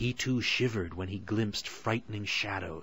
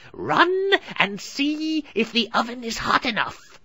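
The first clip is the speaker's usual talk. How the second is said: high, loud, hurried order; impatient witch; 7 dB louder than usual. A narrator's reading. Witch orders Gretel to check oven, impatient. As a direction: snap at the girl. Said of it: "hungry and impatient".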